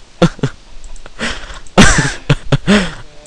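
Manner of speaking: long breathy laugh; helpless run of laughter; real laughing fit. Real, not acted. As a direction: crack up in bursts. Laughing after fumbling on stream.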